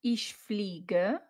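ordinary talk, nothing marked

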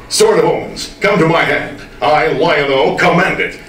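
ringing heroic battle cry; Lion-O hero voice. Shown as a demonstration. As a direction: call the sword like a cartoon hero. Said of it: "When I do the show".

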